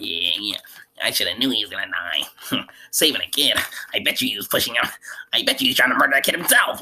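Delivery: whispery, voiceless trash-talk; mock gossiping sneer. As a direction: imitate teachers dogging out the dead boy under their breath. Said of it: "They dogging him out".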